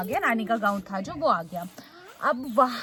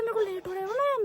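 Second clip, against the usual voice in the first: much higher mock whine of an impatient man; mock conductor; 11 semitones higher than usual. Imitating the conductor who says he's getting late, mocking. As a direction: parrot the conductor's complaint, whiny.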